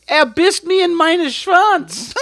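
mock German. Mock German play continues.

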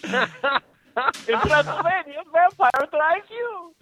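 put-on high, loud, slow comic accent; mock Eastern European comedian accent; Yakov Smirnoff. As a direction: deliver it as an accented punchline, drawn out. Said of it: "not Yakov Smirnoff".

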